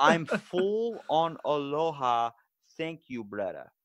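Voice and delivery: put-on slow, drawn-out Hawaiian Pidgin accent. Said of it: "they have an accent called Pidgin".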